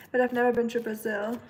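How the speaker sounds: ordinary delivery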